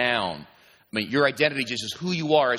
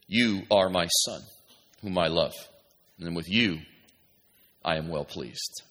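low, slow, hushed scripture reading; voice from heaven; 6 semitones lower than usual. Acted. God speaking to Jesus at his baptism, low and solemn. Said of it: "a voice came from heaven".

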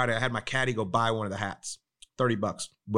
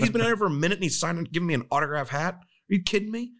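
much higher, indignant retelling of an outraged golfer; retold furious rant; 7 semitones higher than usual. Golfer fuming to his friend about a prank, high-pitched outraged rant. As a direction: play the fuming friend, voice jumping high in disbelief. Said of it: "he is just about ready to lose it".

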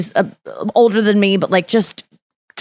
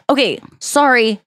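normal talking voice

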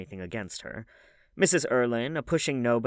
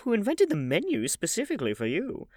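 put-on high, fussy old aristocrat; old lady voice; 12 semitones higher, 3 dB louder than usual. A narrator's reading. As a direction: chatter like a gossipy grand dame. Hostess scolding.

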